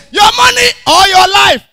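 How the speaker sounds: high, loud robber demand; armed robber shout. Retold or imitated. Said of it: "I'm rubber say".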